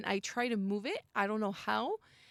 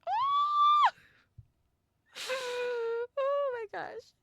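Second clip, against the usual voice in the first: very high, loud scream then shaky laugh; real shriek; 6 dB louder, much slower than usual. Real, not acted. Player jolted by the creature's shriek, screaming. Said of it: "I was part of that shriek".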